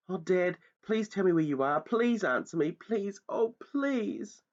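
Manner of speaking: high, pleading, near tears; trembly pleading. Acted. Lost boy pleading for his father in the dark, trembling. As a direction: beg him to answer, voice going. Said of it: "my voice began to go all trembly".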